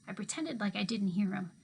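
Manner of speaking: ordinary delivery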